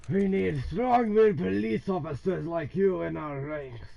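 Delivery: hammed-up Irish brogue, like the game's chief; Irish accent. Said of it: "why he has a fucking Irish accent".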